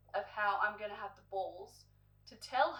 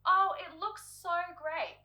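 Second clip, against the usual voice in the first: high, slow fake gushing; mock salon ladies gushing; 9 semitones higher, 6 dB louder, slower than usual. Salon ladies flattering her makeup, high and slow. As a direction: gush slowly like the salon staff. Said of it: "are all saying".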